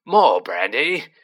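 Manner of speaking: loud, drawn-out yell. A narrator's reading. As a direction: yell it out slowly, as an urgent order. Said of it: "yelled Uncle Vernon".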